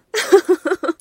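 high cruel laugh; mocking laugh. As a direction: laugh down at the victim. Villain mocking captive.

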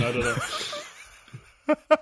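laughing while talking; very high, slow, through laughter. Real, not acted.

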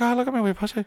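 high, soft mock Irish lilt; Irish girl accent. [name] quoting his girlfriend in a high Irish lilt. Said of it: "She's like".

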